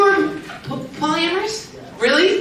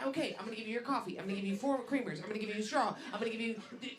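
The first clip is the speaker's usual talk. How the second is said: imitated flight attendant; 4 semitones lower, 12 dB quieter, much faster than usual. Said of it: "You're like".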